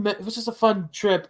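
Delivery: normal talking voice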